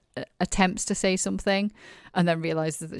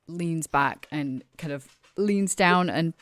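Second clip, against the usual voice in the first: ordinary delivery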